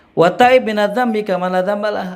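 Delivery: high, loud chanted Arabic; Arabic hadith recitation. Acted.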